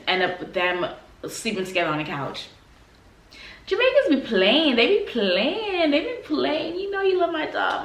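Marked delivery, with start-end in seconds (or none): 3.7-7.8 playful teasing. Real, not acted. Daughter teasing Jamaican parents, high sing-song tease. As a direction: tease them with a knowing grin. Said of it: "teasing Jamaicans".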